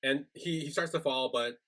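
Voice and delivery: usual speaking voice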